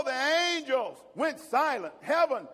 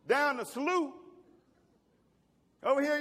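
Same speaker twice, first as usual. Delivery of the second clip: ordinary talk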